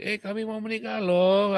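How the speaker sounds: high, loud, bubbly news; excited announcement. Retold or imitated. Couple telling the pastor they will marry, high and loud. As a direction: blurt it out with excitement.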